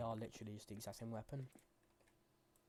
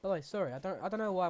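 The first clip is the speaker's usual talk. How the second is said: loud apology as the voice jumps up high; high-pitched; 6 semitones higher, 10 dB louder, faster than usual. Real, not acted. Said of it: "my voice is going so high pitched".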